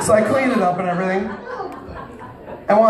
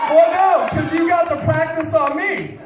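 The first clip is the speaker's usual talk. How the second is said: shouted comeback; 9 semitones higher than usual. Real, not acted. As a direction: yell it back to the heckler. Shouting back at the crowd.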